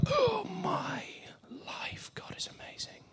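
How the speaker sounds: gasped, barely voiced amazement; awed whisper. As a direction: whisper it in stunned wonder. Awed witness of the miracle.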